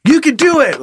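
high, peppy encouragement; over-happy cheerleader. Acted. Toilet cheering on its user, over-happy. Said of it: "somebody extra happy is also annoying".

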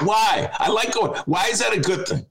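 high-pitched, retold annoyance; indignant protest. Host protesting the new Netflix idea, high indignant retort.

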